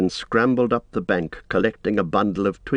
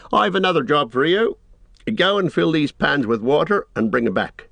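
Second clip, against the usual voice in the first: lightly voiced Sam, higher and brisk in intent; raised-pitch, no-nonsense hobbit; Sam ordering Gollum; 6 semitones higher than usual. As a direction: give orders to a grudging helper.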